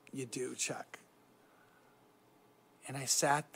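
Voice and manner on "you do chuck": soft, hushed whisper of a kind father; gentle whisper. Retold or imitated. Father quietly telling his son he knows, whispered. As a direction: breathe it gently, almost a whisper. Dad gently confronting son's lie.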